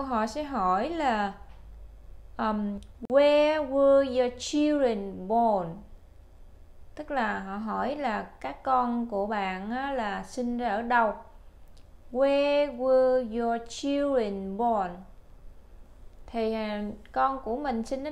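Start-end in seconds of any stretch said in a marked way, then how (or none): 3.1-5.7 slow, clear model question. Shown as a demonstration. Teacher models interview question. 12.1-14.9 slow, clear model question. Shown as a demonstration. Teacher repeats question.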